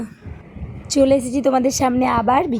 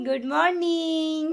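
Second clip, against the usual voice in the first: very slow, high "গুড morning"; drawn-out modelling; 6 semitones higher, much slower than usual. Shown as a demonstration. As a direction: stretch "morning" out long for a child to copy. Models the full greeting for child.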